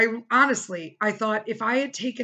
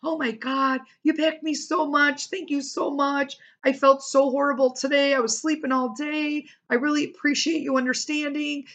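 higher, gushing thanks from the sister; imitating the sister's grateful gushing; 7 semitones higher than usual. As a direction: play the grateful sister, sweetly. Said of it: "she's like".

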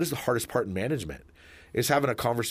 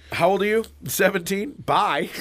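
mock stern questioning; 8 semitones higher, 4 dB louder than usual. Acting out bar check.

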